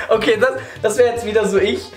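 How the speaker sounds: giggling through the words; laughing while talking. Real, not acted. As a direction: speak through a fit of giggles.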